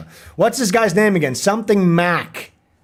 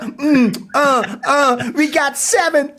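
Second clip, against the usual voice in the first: mock rapper; 9 semitones higher than usual. Mocking the streamer's rap.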